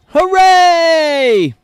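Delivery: high, loud, drawn-out hooray; over-the-top mock cheer. Host on America setting a new record, over-the-top mock cheer.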